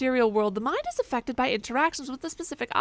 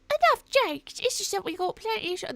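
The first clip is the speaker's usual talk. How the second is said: much higher put-on shopkeeper voice; squeaky character voice; 9 semitones higher than usual. Player voicing a game shopkeeper, high put-on voice. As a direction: play a fussy shopkeeper up high.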